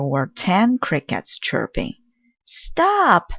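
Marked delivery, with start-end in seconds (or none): 2.4-3.3 a sharp high cry to stop the noise; cries out. A narrator's reading. Mouse crying out at noisy crickets, a high sharp cry. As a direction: stop them with one sudden high cry. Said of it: "cried the mouse".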